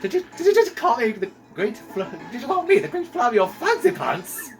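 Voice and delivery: a vain captain stung by an insult; offended Flavio. Acted.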